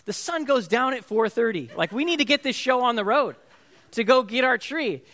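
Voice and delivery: urging the family to hurry before sunset; impatient. Retold or imitated. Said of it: "I'm thinking to myself".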